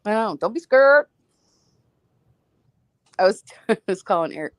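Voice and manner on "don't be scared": high, loud "scared" in a Saint Louis accent. Shown as a demonstration. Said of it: "You sound like a Saint Louisan".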